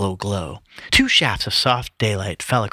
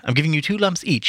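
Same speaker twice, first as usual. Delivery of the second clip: moderately raised, more contained pitch; a polite tea-pourer, only lightly pitched up; Haynes's character voice; 7 semitones higher than usual. A narrator's reading. Haynes pouring tea, reader's retake in milder voice. Second take after "Oh, wait.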